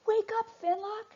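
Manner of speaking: high urgent yelling; yelled. Acted. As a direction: call out to wake a sleeping friend. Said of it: "they yelled at their friend".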